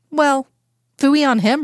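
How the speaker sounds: put-on light Southern accent, lifted pitch. A narrator's reading. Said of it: "her southern accent creeping in".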